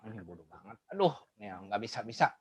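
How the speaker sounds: low, soft, weary scorn; exasperated complaint. Retold or imitated. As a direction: sigh it out, fed up with the pupil. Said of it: "kalau kita bilang".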